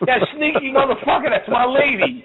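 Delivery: high-pitched indignant outburst; mock outrage. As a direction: act out jealous outrage, voice shooting way up. Retold jealous outrage at Bob.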